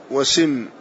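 وسم with the seen taking a short "i"; kasra on وسم. Shown as a demonstration. Teacher giving the third vowelling, وسم said "wa-simm". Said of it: "فبفتح السين وضمها وكسرها".

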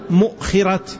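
"مؤخرة" as mu'-khira, stopped hamza after mu; hamza sakina. Shown as a demonstration. Teacher showing students the word, "مؤخرة" pronounced mu'-khira. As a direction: say "مؤخرة" with damma, silent-vowel hamza, then khi. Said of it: "بضم الميم بعدها همزة ساكنة".

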